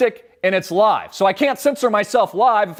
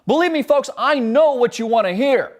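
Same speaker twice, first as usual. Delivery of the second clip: ordinary talk, nothing marked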